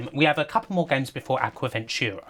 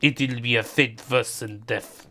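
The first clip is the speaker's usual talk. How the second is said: lightly Russian-accented mock doom; a hint of a Russian accent, playful grave warning; Putin impression. Mock Putin warns Serbia with a light Russian accent. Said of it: "Putin threatening Serbia".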